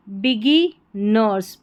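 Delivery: बिगी nurse split slowly in two pieces; broken into syllables. Shown as a demonstration. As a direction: pause between बिगी and nurse. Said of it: "तोड़ के pronounce करना".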